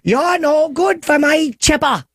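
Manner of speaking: high, slow old-lady put-on voice; a mother scolding her son's girlfriend in a high, slow voice; Dolores Chipperson voice. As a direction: play the nagging mother, stretched out and high. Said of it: "I used to do Dolores Chipperson".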